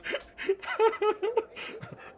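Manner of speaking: high, bubbling giggle; real laughter. Real, not acted. Reader delighted by Nanny's tirade, giggling. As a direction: crack up mid-reading.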